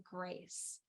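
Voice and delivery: very soft, low single word; hushed revelation. Retold or imitated. A word comes over the dreamer, said low and hushed. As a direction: let the word arrive gently, hushed and low. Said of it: "this word came over me".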